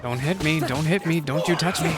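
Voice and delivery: ordinary talk, nothing marked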